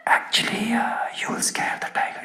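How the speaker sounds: soft unvoiced whisper. Guide warning the comic about the tiger, whispered. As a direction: breathe it in his ear. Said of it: "he whispered in my ear".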